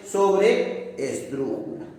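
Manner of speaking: "sobreesdrújula" slowed and broken after sobre; slowly drawn-out word. Shown as a demonstration. Teacher closing the accent summary, slowly drawn-out word. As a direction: draw out "sobreesdrújula" slowly in two parts. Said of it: "si el acento está en la transantepenúltima sílaba".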